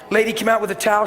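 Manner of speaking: normal talking voice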